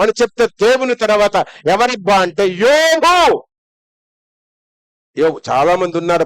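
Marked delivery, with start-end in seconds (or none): 2.5-3.5 shouted answer. Real, not acted. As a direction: ring out యోబు like a triumphant reveal. Preacher names the holiest man.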